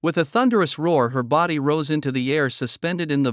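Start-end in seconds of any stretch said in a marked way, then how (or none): none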